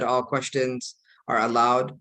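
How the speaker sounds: normal talking voice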